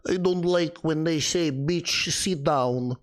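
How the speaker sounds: lightly put-on Russian accent with broken English, "Bitch" and "sit down" said with a long ee sound; a hint of a Russian woman speaking broken English. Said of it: "in a broken English Russian accent".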